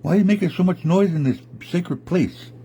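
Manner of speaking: indignant bear, voice lifted high; challenging bear. Acted. Big bear challenges noisy bear.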